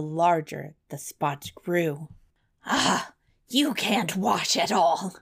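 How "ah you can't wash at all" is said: put-on slow, scornful old troll hag. A narrator's reading. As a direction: sneer slowly like a troll hag. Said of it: "said the old troll hag".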